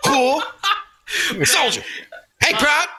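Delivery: imitating a sergeant calling out to a soldier, high and laughing; drill sergeant impression. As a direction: holler at recruits like a hammed-up sergeant through laughter. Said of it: "he'd be like".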